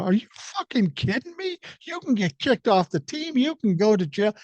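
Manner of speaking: high, alarmed retelling of his own shock; stunned, pitching up at the news; shocked disbelief. Retold or imitated. Shocked at friend's cocaine.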